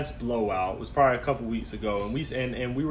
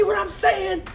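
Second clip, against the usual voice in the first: squeaky mimicking of her; a squeaky take-off of his girlfriend; high mock partner voice; slower than usual. Boyfriend mimics girlfriend's reply, high squeaky voice. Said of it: "she's like".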